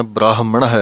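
ਬ੍ਰਾਹਮਣ with an audible ਹ and ਹੈ joined; joined correctly. Shown as a demonstration. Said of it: "ਇਕੱਠਾ ਪਾਠ ਹੈ".

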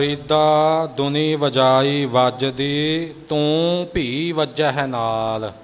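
slow chant with each word set apart; ਧੀਮਾ ਤੇ ਵੱਖਰਾ ਵੱਖਰਾ ਪਾਠ. Shown as a demonstration. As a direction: recite it slowly, word by word. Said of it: "ਇਉਂ ਵੱਖਰਾ ਵੱਖਰਾ ਪਾਠ ਕਰਨਾ ਹੈ".

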